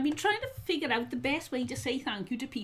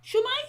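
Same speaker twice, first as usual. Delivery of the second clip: "Shwmae?" higher, rising like a question; greeting someone; 10 semitones higher, 4 dB louder than usual. Shown as a demonstration. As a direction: lift "Shwmae?" right up at the end. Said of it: "say to them".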